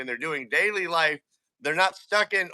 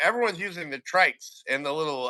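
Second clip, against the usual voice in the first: normal talking voice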